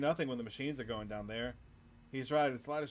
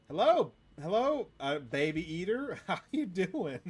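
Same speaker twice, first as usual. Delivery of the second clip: high, loud, laughing fake hello; mock friendly greeting; 5 semitones higher, 6 dB louder, slower than usual. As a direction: greet him with amused fake politeness. Mock greeting to baby eater.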